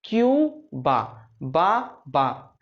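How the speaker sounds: very slow drawn word. Shown as a demonstration. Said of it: "drilling the word".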